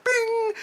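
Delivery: very high ringing bell imitation; ding like a boxing bell. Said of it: "the bell was like".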